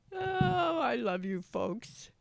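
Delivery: playful falsetto. Pretend. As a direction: croon it high to the listeners. Host signing off warmly.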